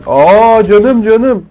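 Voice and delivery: sudden panic under fire; alarmed. Real, not acted. Gamer ambushed mid-sentence, panicked cry.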